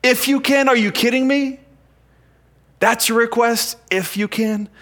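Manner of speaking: high, incredulous "If you can, are you kidding me?"; incredulous retort. Acted. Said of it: "Jesus jumps on this".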